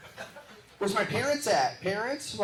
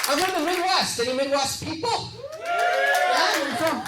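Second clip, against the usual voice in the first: calling out; 11 semitones higher, 5 dB louder than usual. Real, not acted. Calling out to the audience.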